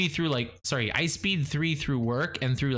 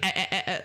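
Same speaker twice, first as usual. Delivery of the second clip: higher eh eh eh stutter; mock stammer; 7 semitones higher, much faster than usual. Someone pretending to be her, higher mock stutter. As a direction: mimic her own hesitation. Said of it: "pretending to be me and they were like".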